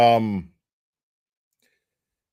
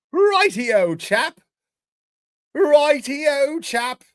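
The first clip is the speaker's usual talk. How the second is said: high, loud hammed-up English righty-o; teasing Cherry in mock British voice; mock English accent; 12 semitones higher, 6 dB louder, much slower than usual. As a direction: toss off a cartoon British righty-o.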